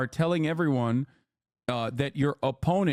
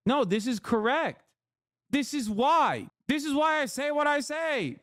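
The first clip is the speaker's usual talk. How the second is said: emphatic; 10 semitones higher than usual. Real, not acted. Emphatic agreement.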